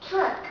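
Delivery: Truck said high with a crisp TR; Truck, TR released sharply; clear true T. Shown as a demonstration. Teacher telling students to listen.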